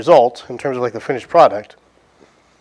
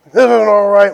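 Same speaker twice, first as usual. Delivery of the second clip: loud, higher, hammed-up angry complaint; mad old customer; 6 semitones higher, 7 dB louder than usual. Old guy slamming the cheeseburger down, mock angry. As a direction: bark it like an irate old man. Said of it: "he was mad".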